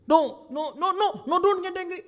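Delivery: high, loud repeated "no"; urgent pleading. Acted. God inside begging the listener not to get angry, loud and high. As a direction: cry out to stop them.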